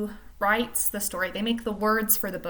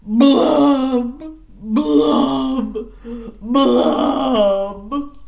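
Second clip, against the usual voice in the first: slow, louder, mopey singsong refrain; gloomy pout fish; 3 semitones higher, 9 dB louder, much slower than usual. Acted. Teacher reads the pout fish's gloomy refrain, slow and loud. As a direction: drag out every word like a sulking fish.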